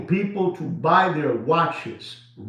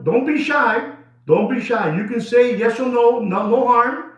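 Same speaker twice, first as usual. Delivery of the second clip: teasing coaxing; 6 semitones higher, 3 dB louder than usual. Acted. Coaxing a shy friend on camera.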